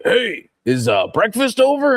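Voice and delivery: put-on high crotchety old man heckler; lightly voiced cranky old Muppet. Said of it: "the two old men".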